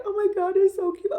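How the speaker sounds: high gushing mock crier; mocking an easily moved crier. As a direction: gush over something tiny, teasing. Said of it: "you do got criers out there".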